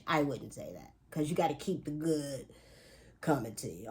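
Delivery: usual speaking voice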